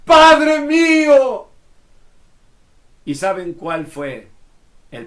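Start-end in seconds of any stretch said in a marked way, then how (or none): 0.0-1.5 high, loud, slow anguished cry. Acted. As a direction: call out to a departing father. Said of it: "gritaba Eliseo".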